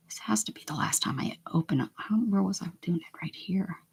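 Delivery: whispered to herself; soft focused whisper. Real, not acted. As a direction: breathe it out, no voice. Whispering to herself while painting.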